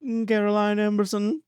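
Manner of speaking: high, put-on squeaky voice; high silly voice. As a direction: sing the name out high. Silly patron voice.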